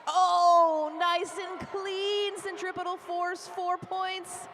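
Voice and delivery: high, loud, thrilled play-by-play; real thrill at the scoring pass; excited call. Real, not acted. Live call of a scoring jam.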